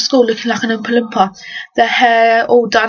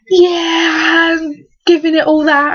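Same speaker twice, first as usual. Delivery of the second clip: mock cocky boy; 6 semitones higher, slower than usual. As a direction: shout it like a cocky lad giving it large. Mocking boys showing off.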